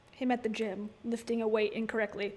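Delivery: ordinary delivery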